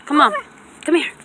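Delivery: ordinary delivery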